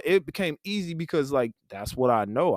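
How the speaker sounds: ordinary delivery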